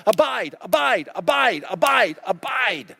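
slowing, insistent "abide" over and over; insistent repetition. Retold or imitated. Jesus urging disciples, slowing insistent repetition. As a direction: press "abide" again and again, slowing. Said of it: "telling his disciples over and over".

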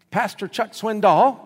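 a stranger's voice asking a question; caller's voice on the phone. Retold or imitated. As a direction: call out a name down the phone line. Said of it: "this voice said".

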